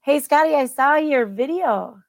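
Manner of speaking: ordinary talk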